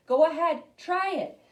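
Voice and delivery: plain reading voice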